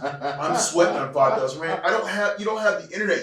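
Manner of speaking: high, laughing panic; laughing about sweating the fine; laughing retell. Real, not acted. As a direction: laugh through the worry.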